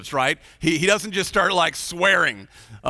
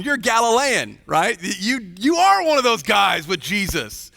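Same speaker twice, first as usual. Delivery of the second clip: higher, louder pointed accusation; accusing crowd; 5 semitones higher, 4 dB louder than usual. Retold or imitated. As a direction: catch him out, pointing at him.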